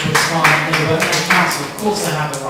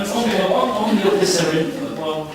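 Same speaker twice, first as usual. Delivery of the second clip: ordinary talk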